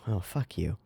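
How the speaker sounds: usual speaking voice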